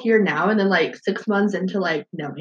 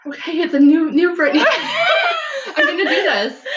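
higher, louder quote broken by laughter; psyched-up retelling; 10 semitones higher, 5 dB louder than usual. Retold or imitated. New student retelling a dare to a friend, psyched-up. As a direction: retell your own bold resolve, laughing. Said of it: "And I'm like".

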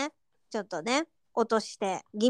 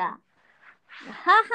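slow, deliberate ha ha ha ha; fake laugh; 5 semitones higher, 3 dB louder, much slower than usual. Shown as a demonstration. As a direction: drag out a hollow fake laugh. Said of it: "はって笑ってなくない".

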